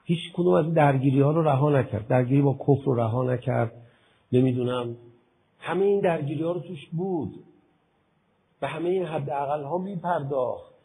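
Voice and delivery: loud, fervent sermon voice; preaching at full force. Acted. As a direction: preach it hard to the hall. (با شور و صدای بلند موعظه کن)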